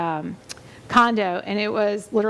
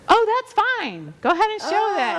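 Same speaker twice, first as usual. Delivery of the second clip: hammed-up fake niceness once she learns who you are; sudden sweetness; 11 semitones higher than usual. Agent suddenly sweet to listing agent.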